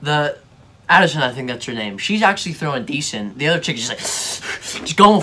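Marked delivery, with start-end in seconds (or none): none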